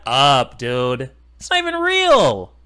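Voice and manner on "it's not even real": high, louder sneering take of It's not even real; mocking repeat. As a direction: sneer the same line pitched up.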